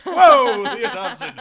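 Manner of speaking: high, loud surprised gasp; shocked whoa. Retold or imitated. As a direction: react in laughing shock. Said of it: "I was like".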